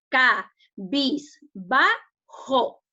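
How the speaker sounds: "ca, bis, ba, jo" as four separate beats; syllable by syllable. Shown as a demonstration. Teacher splits a word for the class, "ca, bis, ba, jo" syllable by syllable. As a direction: break it into "ca", "bis", "ba", "jo" with a gap after each. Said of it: "que lo dividimos en sílaba".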